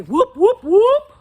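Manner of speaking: high, loud whoops, climbing on the last; very loud whooping. Shown as a demonstration. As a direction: whoop it out at full volume. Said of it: "very loudly".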